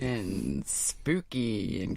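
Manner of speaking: low, slow cartoon villain. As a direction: drag it out like a cartoon baddie. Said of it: "The cartoon villain voice is funny".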